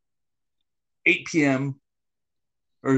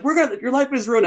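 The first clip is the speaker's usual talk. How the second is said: raised, mock scammer threat; imitating threatening caller; 8 semitones higher than usual. Retelling scam calls, mock threatening voice. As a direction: play the caller a little higher and harsher. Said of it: "actually calling me, like, some threatening".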